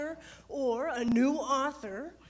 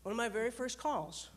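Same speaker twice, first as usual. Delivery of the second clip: ordinary talk, nothing marked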